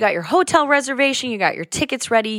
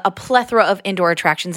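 normal talking voice

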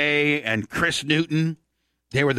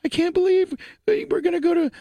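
imitating crying fiancée. Husband mimicking his fiancée's tearful falsetto. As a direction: whine it like a weepy small-town girl. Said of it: "My fiance, Jill".

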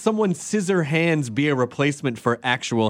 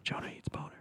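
voiceless teasing whisper; 18 dB quieter, faster than usual. Real, not acted. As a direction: whisper it sneakily.

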